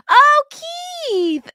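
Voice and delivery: gasp, then high, loud joyful "Keith"; thrilled to see his name; delighted surprise. Real, not acted. Delighted at supporter's donation.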